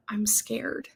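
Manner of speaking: softly spoken, retold confession; timid admission. Dreamer admitting fear to the woman, timid and soft. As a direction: drop the voice for a small admission.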